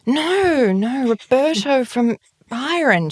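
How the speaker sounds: high-pitched mock insistence, laugh breaking in; insistent correction. Insisting on the wrong Roberto.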